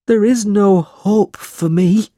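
high, slow, wailing despair; a boy crying his heart out, high and slow. A narrator's reading. Smike pouring out his misery to Nicholas, high tearful wail. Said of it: "cried Smike".

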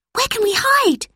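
put-on very high girl voice, panicky; frightened girl. A narrator's reading. Lucy asks Robert where to hide. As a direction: ask in a small panic.

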